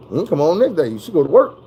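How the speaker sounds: ordinary talk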